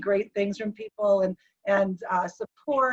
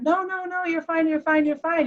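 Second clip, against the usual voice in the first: higher, hurried soothing repetition; urgent reassurance; 6 semitones higher than usual. Retold or imitated. Wife to husband who says he's dying, urgent reassurance. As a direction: reassure anxiously, voice lifted.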